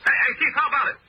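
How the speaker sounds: high, insistent call. Acted. Photographer calls to the police chief, insistent.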